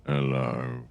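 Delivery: groggy just-woke-up voice; drowsy, low and soft; sleepy voice. Real, not acted. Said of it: "you sound like we just lying in bed".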